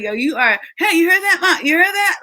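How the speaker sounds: high, loud bragging call; hyped gloating. Retold or imitated. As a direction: show off to Mom, thrilled. Son gloating again to mom.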